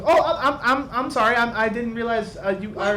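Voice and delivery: high, embarrassed stammer; flustered apology. Acted. Whitnan apologizes.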